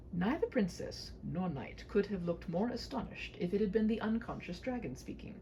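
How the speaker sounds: plain reading voice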